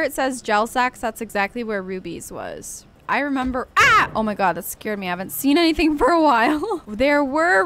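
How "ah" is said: sudden high loud cry; startled yelp. Real, not acted. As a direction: jump at a fright. Startled by creature.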